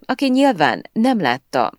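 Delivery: ordinary delivery, nothing marked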